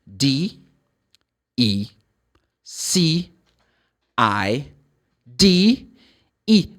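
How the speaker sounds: each letter alone with a pause between; spelling out loud. Shown as a demonstration. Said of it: "Let's check the spelling".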